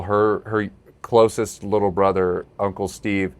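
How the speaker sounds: ordinary talk, nothing marked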